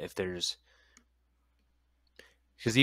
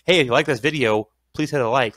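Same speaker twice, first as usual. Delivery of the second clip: louder, higher YouTuber plug; YouTube voice; 5 semitones higher, 8 dB louder than usual. Retold or imitated. Host imitating a YouTuber asking for likes, louder and higher. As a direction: lightly imitate an upbeat channel call to action. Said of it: "or, like, YouTube voice".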